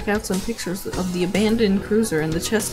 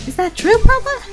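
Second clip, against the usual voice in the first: high, bubbly mother voice; cheerful mom voice; 7 dB louder than usual. Acted. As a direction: chirp it like a doting mom.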